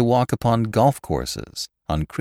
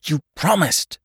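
higher-pitched accusing hiss; angry hiss; 7 semitones higher than usual. A narrator's reading. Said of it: "and hissed".

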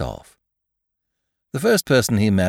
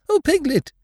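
high, excited storybook bear; bursting with news in a high bear voice; Pooh, excited. Acted. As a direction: greet a friend excitedly, voice pitched right up. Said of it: "said Pooh excitedly".

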